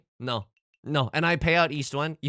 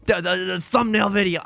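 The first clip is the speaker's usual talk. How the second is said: mock-indignant put-on voice, higher and louder; mock outraged voice; 6 semitones higher, 6 dB louder than usual. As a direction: play an outraged mob boss whose daughter's day was ruined. Put-on offended voice.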